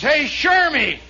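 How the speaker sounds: high, loud yell to the sergeant; shouted call, stretched at the end; hollered report. Acted. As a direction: bellow the news across the barracks. Soldier calls out to sergeant.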